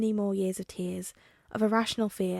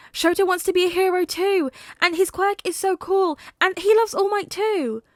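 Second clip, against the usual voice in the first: put-on high, loud child voice gushing; excited little boy; 9 semitones higher, 7 dB louder than usual. A narrator's reading. Son tells his father about his new friend, excited child. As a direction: tumble it out like an eager small boy.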